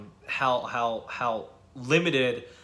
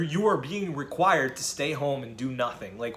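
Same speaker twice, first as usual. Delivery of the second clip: normal talking voice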